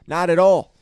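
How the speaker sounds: loud, exasperated rejection of the idea; loud emphatic denial. Real, not acted. Fed-up bettor ranting at a bad favorite, loud. Ranting about his bet's loss.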